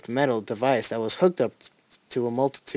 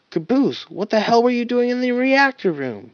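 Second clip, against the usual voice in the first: much higher, louder demand; 11 semitones higher, 4 dB louder than usual. Acted. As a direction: demand an answer hard. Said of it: "he demanded".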